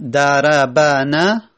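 دربنه spoken slowly with all four short vowels; slow دربنه to show four moving letters; slow demonstration. Shown as a demonstration. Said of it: "چهار حرکت سنگینه". (آهسته برای نشان دادن چهار حرکت)

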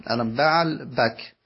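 "بعل بک" with fatha, "baʕla bak", slowly; fatha on first part. Shown as a demonstration.

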